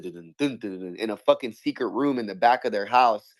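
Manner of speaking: usual speaking voice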